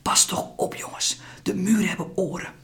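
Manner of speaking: urgent, hushed whisper; a mother whispering a warning to the boys. Acted. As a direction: warn them without a sound. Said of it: "fluistert ze".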